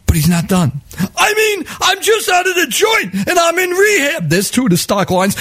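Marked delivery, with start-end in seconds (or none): 1.2-4.3 panhandler's pitch. Retold or imitated.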